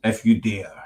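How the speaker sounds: low, soft dare; mock spooky teaser. Host finishes co-host's location trailer, mock-sinister. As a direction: drop it low and quiet, mock-ominous.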